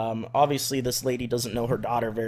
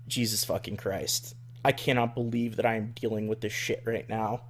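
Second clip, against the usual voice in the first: muttered disbelief; 4 semitones lower, 7 dB quieter than usual. Retold or imitated. Inner exasperated thought.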